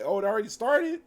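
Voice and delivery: high, loud surprised question. Retold or imitated. Nora learning the apocalypse began, high loud surprise. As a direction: blurt it out, caught off guard. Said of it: "she's like".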